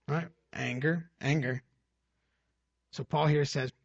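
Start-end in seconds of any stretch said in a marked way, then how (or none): none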